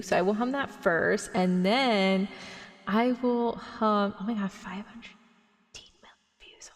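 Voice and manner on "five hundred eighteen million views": amazed whispered aside; whispering. Real, not acted. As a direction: whisper it in awe.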